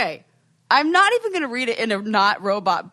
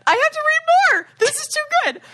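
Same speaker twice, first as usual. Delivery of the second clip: high, loud and thrilled; excited; 5 dB louder than usual. Real, not acted. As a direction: squeal with delight. Excited reading reaction.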